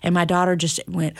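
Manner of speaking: usual speaking voice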